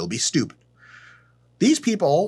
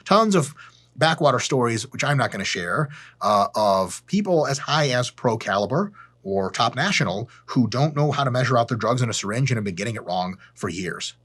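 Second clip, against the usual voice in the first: ordinary talk, nothing marked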